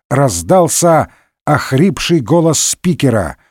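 ordinary delivery, nothing marked

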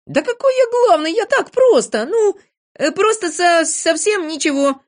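very high, flustered backpedalling; defensive, loud, high voice; making excuses. A narrator's reading. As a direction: squirm out of blame, stammering. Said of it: "оправдывался Незнайка".

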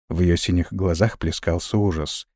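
ordinary delivery

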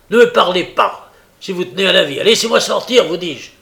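loud, high angry warning; furious threat. A narrator's reading. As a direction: threaten and demand in a shout.

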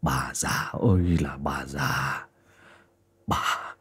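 hissed low and soft through his teeth. A narrator's reading. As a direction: hiss at her with contained rage. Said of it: "mà rít lên".

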